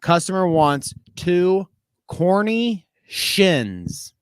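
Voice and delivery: slow, drawn-out "corny shins" read off the note; reading the misspelling. Retold or imitated. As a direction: spell out "corny shins" as written, slowly, each syllable hammed. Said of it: "but it said".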